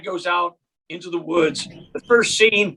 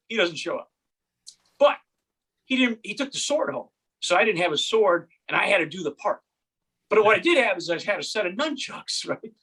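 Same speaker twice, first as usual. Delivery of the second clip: ordinary delivery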